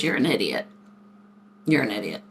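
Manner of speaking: low, deadpan dad verdict; imitated father. Father reacting to daughter's mistaken tattoo, imitated low deadpan. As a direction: drop the voice for dad's put-down.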